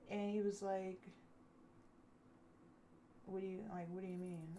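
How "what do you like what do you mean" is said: soft, halting imitation; evasive mumbled reply. Man dodging her question about content, evasive reply. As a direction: play dumb quietly, stumbling on the start.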